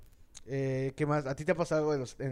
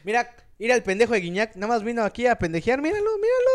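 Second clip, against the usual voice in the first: louder, higher jeering; mock heckling dad; 6 semitones higher, 6 dB louder than usual. Father jeering a player beside his son, loud mock jeer. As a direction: jeer at the player for your kid.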